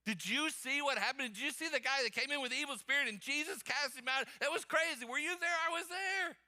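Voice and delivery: high, eager acted chatter; excited gossip. Barbershop customers telling what happened in church, acted excitedly. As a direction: rush it out like amazed gossip.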